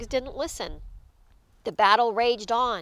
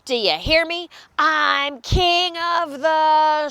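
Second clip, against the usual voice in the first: snarling bully; 4 semitones higher than usual. A narrator's reading. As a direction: proclaim yourself king with a snarl. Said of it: "he snarled".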